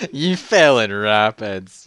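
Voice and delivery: loud, drawn-out taunt; mocking brother. Relative laughing at the fallen kid, loud slow taunt. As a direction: jeer it slowly and loudly. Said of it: "He's like".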